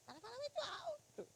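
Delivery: high-pitched but soft mock complaint; mimicked complaint. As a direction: imitate a complainer in a small high voice. Mimicking complaint about loud talking.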